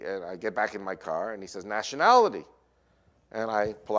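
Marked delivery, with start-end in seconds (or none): 1.6-2.4 sharp rise in pitch and volume; officer's demand. Retold or imitated. A border officer to a driver, loud demand.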